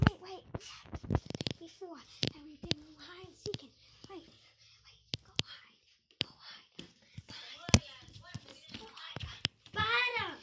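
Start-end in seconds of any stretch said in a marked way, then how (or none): 0.1-9.2 urgent whisper. Real, not acted. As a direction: hiss the hiding order. Hiding game.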